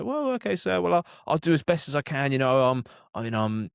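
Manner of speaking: high, wide-eyed innocent politeness. Retold or imitated. Cabbie mimicking himself calming an impatient fare, innocent and high. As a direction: play it sweet so nobody can get mad. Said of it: "in a real innocent way".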